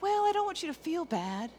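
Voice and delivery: soft soothing mom. Retold or imitated. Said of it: "sometimes we start going".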